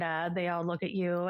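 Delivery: ordinary delivery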